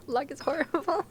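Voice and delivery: normal talking voice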